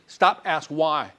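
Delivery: stop, ask why, spoken slowly with pauses; slow, deliberate. Shown as a demonstration. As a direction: spell out each step slowly. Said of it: "slow instruction".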